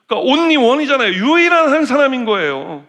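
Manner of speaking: emphatic, drawn-out only one; stress on only one. Real, not acted. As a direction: lean hard on only one. Preacher stressing the point.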